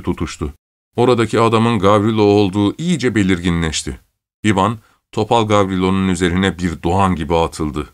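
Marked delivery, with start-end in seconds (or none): none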